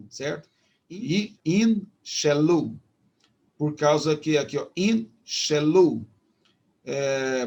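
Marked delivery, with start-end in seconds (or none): 1.5-2.8 slow "in shelu", split after "in"; syllable-by-syllable demo. Shown as a demonstration. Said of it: "você pronuncia só o mem". 4.8-6.1 slow "in shelu", parted after "in"; syllable-by-syllable demo. Shown as a demonstration. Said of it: "você pronuncia só o mem".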